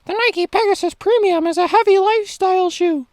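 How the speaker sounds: very high, loud squeaky mock voice; exaggerated high-pitched whiny naysayer; mocking critic's voice. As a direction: mimic the haters in a silly high squeal. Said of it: "read it in the voice of the people who would actually say this".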